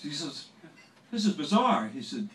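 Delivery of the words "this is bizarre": loud, high scolding demand; loud and high, like a star catching a trespasser; hammed-up angry celebrity voice, loud and high. [name] voiced in story.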